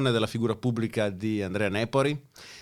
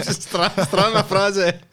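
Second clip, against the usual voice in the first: laughing; 7 semitones higher, 5 dB louder, much slower than usual. Real, not acted. Laughing at odd TTS sentence.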